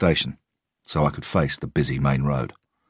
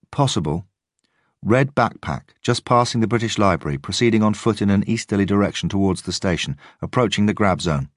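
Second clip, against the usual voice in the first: doubtful; 5 semitones higher than usual. A narrator's reading. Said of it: "all the doubt creeping in".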